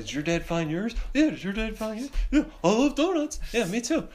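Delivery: high-pitched little-kid imitations; chatting kids. Kids comparing notes about dads and donuts, high mock child chatter. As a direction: trade lines in put-on children's voices. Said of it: "a couple of us are, like, over there talking".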